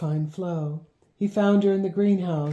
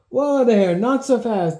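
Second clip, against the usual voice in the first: demanding call; 7 semitones higher, 7 dB louder than usual. Acted. Man calling to the racing kids, loud demanding shout. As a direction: rein them in with a loud shout. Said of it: "demanded Childs".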